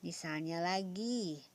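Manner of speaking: ordinary talk, nothing marked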